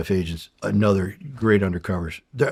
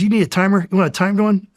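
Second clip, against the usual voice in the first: higher and louder, mock-helpful; teasing offer; 11 semitones higher, 6 dB louder, faster than usual. Explosives guy teasing the agent about a timer, higher, sarcastic. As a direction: needle him with a sarcastic offer.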